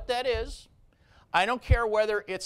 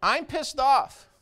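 pissed off; 5 semitones higher, 4 dB louder, slower than usual. Real, not acted. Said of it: "Don't mistake what I'm doing as passion for anger".

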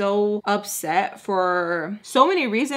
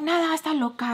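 high put-on voice in Spanish; mimicked dismissal; 8 semitones higher, faster than usual. Quoting her dismissing it in Spanish, high mimicry. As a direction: brush it off in a raised voice.

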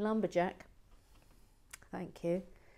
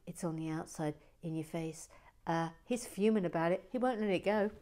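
ordinary talk

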